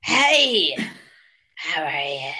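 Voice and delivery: put-on Dublin greeting, dropping low at the end; Dublin accent. Greeting a stranger in the street, Dublin accent. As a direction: play at a Dublin hello. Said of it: "doing this in a dub accent".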